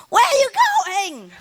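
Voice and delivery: very high, drawn-out parrot call; indignant bird calling. Retold or imitated. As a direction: call after the owner in a high, slow squawk. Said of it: "I don't come in".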